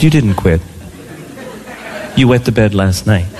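very low monotone parent; stern parent. Retold or imitated. Parent disputes child's bed-wetting denial, mock stern. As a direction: contradict in a deep, deadpan voice.

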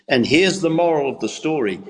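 ordinary talk, nothing marked

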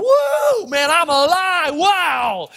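very high, drawn-out whoop of joy; exhilarated whoop. Acted. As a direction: whoop as if just plunged into cold water. Acts out hitting cold water.

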